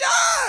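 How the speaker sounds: high, loud crowd cheer; the audience screaming back; crowd roar. Retold or imitated. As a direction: crowd yelling along with the chair smash. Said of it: "everyone's like".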